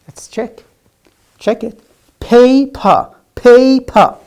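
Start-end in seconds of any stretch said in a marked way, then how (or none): 2.2-4.2 loud "Paper" with puffed P on both syllables; higher, punched "Paper", testing the air on each P; emphatic aspirated P sounds in "Paper"; clear P sounds. Shown as a demonstration.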